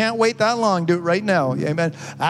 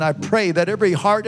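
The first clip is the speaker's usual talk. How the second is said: ordinary talk, nothing marked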